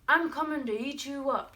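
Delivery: loud, high-pitched troll threat; troll's yell. Acted. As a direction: yell a storybook monster's threat. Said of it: "yelled the troll".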